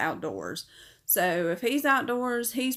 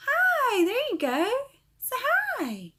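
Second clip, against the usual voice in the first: much higher sing-song voice to coax a bird; coaxing the parrot. Real, not acted. Owner coaxing her parrot Ozzy to say hi, high sing-song. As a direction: coo up high to get him talking.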